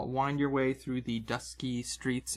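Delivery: ordinary delivery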